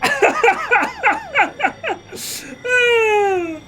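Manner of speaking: high-pitched words squeezed through laughter; laughing so hard it sounds like crying; cracking up. Real, not acted. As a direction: talk through real, tearful laughter. Cracking up at the game.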